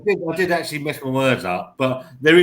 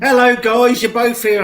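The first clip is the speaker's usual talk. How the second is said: higher, louder mock-bright hello; cheery greeting; 10 semitones higher, 4 dB louder than usual. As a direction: greet like an overexcited host. Staff greeting them, acted quote.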